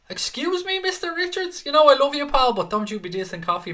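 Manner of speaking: very high, squeaky mock offence; playfully outraged high-pitched protest; mock indignation. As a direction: squeal in comic affront, easing down toward the end. Mock offence at coffee insult.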